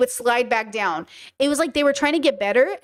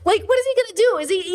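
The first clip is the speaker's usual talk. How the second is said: ordinary delivery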